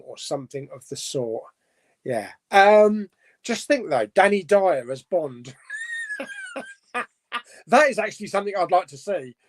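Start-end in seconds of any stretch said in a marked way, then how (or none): 5.6-6.7 high shrieking burst of laughter; squealing laugh. Real, not acted. Laughing at the idea of Danny Dyer as Bond.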